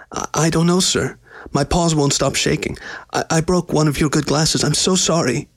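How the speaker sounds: high, stammering, shaken apology; frightened servant pleading with his master; almost frantic. A narrator's reading. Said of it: "Slave sounded almost frantic now".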